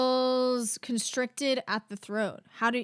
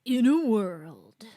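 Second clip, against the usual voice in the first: slow, deep trailer voice; trailer announcer voice; much slower than usual. Shown as a demonstration. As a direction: draw it out like a movie trailer. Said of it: "deep, announcer voice".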